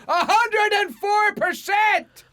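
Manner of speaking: high, loud, drawn-out shout; defiant shout. Acted. China outbidding America on tariffs, slow high shout. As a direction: bellow the number out slowly.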